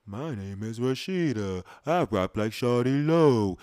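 slow, put-on voice; imitating a rapper. Quoting a woman from his friend's area, slow put-on voice. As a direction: drawl it out like her. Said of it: "the woman who said".

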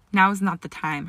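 plain reading voice

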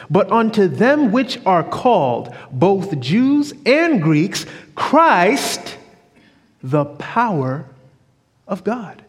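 slow, drawn-out solemn reading; slow scripture reading. Acted. Preacher reading verse to church, slow and solemn. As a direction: lift the scripture slowly to the congregation.

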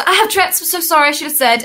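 rushed, high, apologetic explanation; fast apology. Retold or imitated. Explaining her Tourette's to the nurse, rushed and high. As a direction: hurry the apology out in a fluster. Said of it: "I explained really fast".

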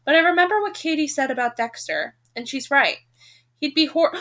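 ordinary talk

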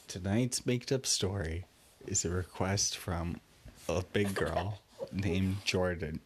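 normal talking voice

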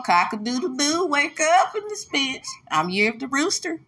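high, crowing rooster call. Pretend. Waking up the crowd as a rooster, high crowing. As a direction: crow it out like a rooster waking everyone.

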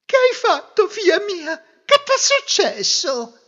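high, loud cry of motherly alarm. Acted. Mother asking her crying daughter, high-pitched alarm. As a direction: fret loudly over a weeping child.

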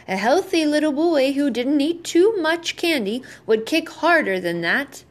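reproving. A narrator's reading. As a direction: gently reprove a young patient, pitch lifted. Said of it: "the doctor reproved him".

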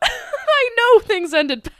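high, slow hammy voice; mock dramatic. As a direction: ham it up dramatically.

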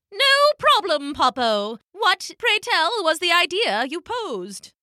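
put-on high, prim old penguin hen; a lightly voiced fussy elder. Elder female penguin scolding the thief, high and prim.

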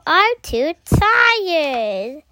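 slow, dragging sleepy reply; sleepy tired. Acted. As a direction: stretch it out like a tired hippo. Tired hippo answers dragon.

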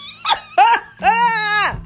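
high burst of laughter; high cackle. Real, not acted. Laughing at the demon's plea, high cackle. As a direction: break into a delighted cackle.

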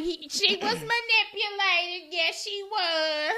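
high slow mocking mom; lightly voiced mom, slow and sing-song. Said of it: "She was like".

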